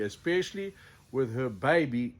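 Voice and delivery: usual speaking voice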